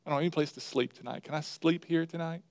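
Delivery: mildly voiced snake begging for a bed, quiet and hurried; pleading snake. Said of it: "He said".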